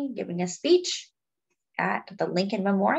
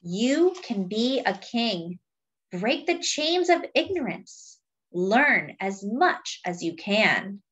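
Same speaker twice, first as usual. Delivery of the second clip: slow, weighty refrain then lively lines; storybook reading with a slow refrain; reading to children; slower than usual. Acted. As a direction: read the book aloud to the class. Reading picture book to children.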